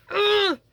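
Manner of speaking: a sharp high ugh, fighting back tears; frustrated groan. Real, not acted. Vlogger unboxing her dog's ashes, frustrated high groan. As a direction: let out a strained high groan.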